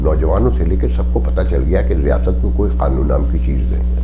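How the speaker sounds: impassioned outcry. Real, not acted. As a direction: hammer every line like a protest speech. Impassioned tirade against the state. (پرجوش بلند آواز میں تقریر)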